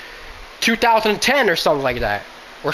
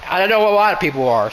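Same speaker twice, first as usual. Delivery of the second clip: ordinary delivery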